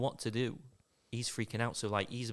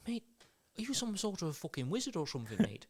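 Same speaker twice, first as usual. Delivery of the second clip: higher-pitched, hurried impression voice; incredulous mate in a quick, higher voice; 9 semitones higher, 4 dB quieter than usual. Comic telling of one fish fryer marvelling at another, Devo impression. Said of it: "I sound a bit like [name] now".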